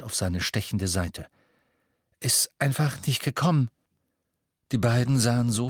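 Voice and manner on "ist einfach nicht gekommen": winded, slightly higher voice; breathless excuse; panting. A narrator's reading. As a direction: gasp it out after running. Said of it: "keuchte er".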